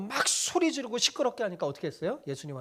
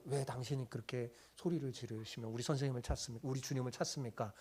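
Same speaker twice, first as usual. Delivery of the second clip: a softer, kinder question; gentle asking; 5 semitones lower, 10 dB quieter than usual. Pretend. What the disciples should have asked the woman, softly. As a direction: ask her quietly, with concern. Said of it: "이렇게 물어보면서".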